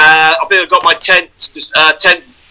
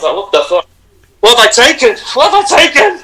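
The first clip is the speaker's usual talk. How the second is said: rising higher with each question; mock bewildered panic; 7 semitones higher than usual. Seeing fifty of his own face, rising mock panic. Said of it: "I was like".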